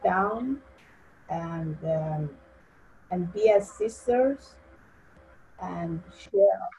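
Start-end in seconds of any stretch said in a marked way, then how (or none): none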